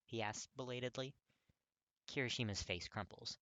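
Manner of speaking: ordinary delivery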